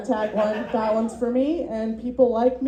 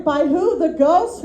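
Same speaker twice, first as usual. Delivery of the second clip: incredulous; 5 semitones higher than usual. Retold or imitated. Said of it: "I was like".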